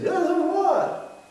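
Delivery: high, slow, dismissive so what; mocking a careless shrug. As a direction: toss it off like nothing matters.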